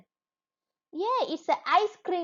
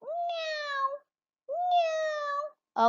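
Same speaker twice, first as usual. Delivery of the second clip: very high mewing meow; teacher mewing for the children; cat meow imitation. Shown as a demonstration. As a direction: mew like a tiny kitten, way up high. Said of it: "What is the cat sound?".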